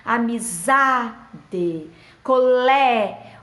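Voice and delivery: "Amizade" and "colé" very slow, "za" and "lé" stretched and punched; stressed tonic syllable. Shown as a demonstration. Teacher showing the strongest syllable, "za" and "lé" held long. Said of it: "é a sílaba mais forte".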